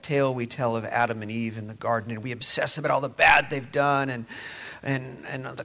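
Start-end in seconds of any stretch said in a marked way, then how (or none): none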